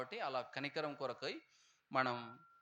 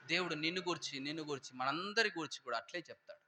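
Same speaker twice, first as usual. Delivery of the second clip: ordinary talk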